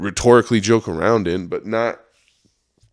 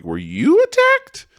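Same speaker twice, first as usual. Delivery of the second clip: incredulous question; 7 dB louder, slower than usual. Retold or imitated. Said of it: "I was like".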